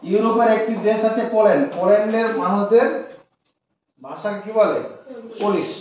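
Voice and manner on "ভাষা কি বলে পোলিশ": ordinary talk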